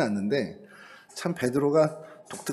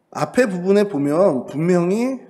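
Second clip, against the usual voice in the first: ordinary talk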